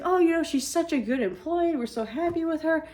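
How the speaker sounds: high, syrupy imitation of the boss; fake-sweet boss. Boss sucking up to the mom, high and sugary.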